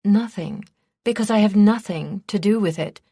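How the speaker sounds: ready, insistent disclaimer; prompt denial. A narrator's reading. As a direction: answer at once, protesting innocence. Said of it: "she replied promptly".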